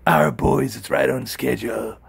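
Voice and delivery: ordinary talk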